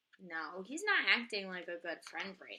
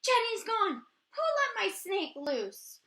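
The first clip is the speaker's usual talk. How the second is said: much higher and much louder, a frantic cry; panicked shout; 9 semitones higher, 9 dB louder, slower than usual. Acted. Emily yelling to the class that her snake escaped. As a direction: shout it across the classroom in alarm. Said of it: "Emily shouts".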